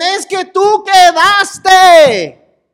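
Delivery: put-on a child nagging a parent who promised to buy something; whining child. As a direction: play the kid demanding the promised toy. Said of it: "es cuando le dicen".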